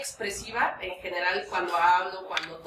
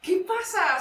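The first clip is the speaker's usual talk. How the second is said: tender; 7 semitones higher, 3 dB louder, slower than usual. Retold or imitated. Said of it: "Y fue como de".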